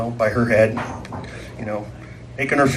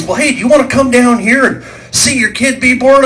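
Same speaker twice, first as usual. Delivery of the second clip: cheerful doctor; 10 semitones higher than usual. Retold or imitated. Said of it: "the doctor says".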